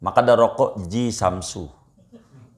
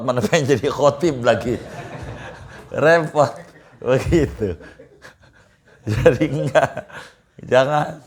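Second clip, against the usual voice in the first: laughing; 5 semitones higher than usual. Real, not acted. Joking about preaching duty.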